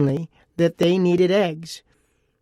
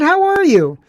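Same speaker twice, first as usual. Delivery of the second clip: bright, much higher and louder cheery hello; jovial greeting; 12 semitones higher, 7 dB louder than usual. Retold or imitated. Host greeting a young woman at the grocery store, jovial and bright. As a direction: greet her like you're glad to see her. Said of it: "in a pretty jovial tone".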